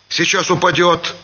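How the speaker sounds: ordinary delivery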